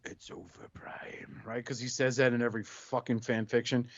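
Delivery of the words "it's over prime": breathy menacing villain whisper. Acted. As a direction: hiss the line low like a gloating villain. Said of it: "Megatron looks at him and goes".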